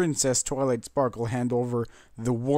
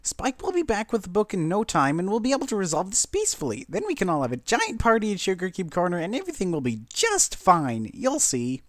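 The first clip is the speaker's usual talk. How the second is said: Pinkie; 8 semitones higher than usual. A narrator's reading. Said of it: "Pinkie Pie".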